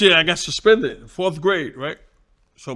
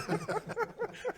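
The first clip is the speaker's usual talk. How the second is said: high laugh; 16 dB quieter than usual. Real, not acted.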